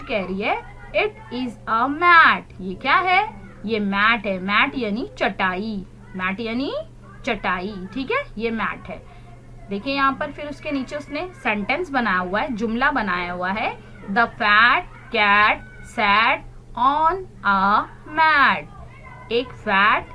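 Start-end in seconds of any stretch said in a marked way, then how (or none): 1.0-2.5 very slow, loud it is a mat; slow model reading. Shown as a demonstration. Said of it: "Repeat after me". 14.1-19.3 very slow, louder sentence read; slow model reading. Shown as a demonstration. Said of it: "Repeat after me".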